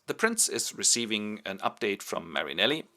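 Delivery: ordinary delivery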